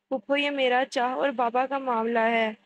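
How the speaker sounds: ordinary delivery, nothing marked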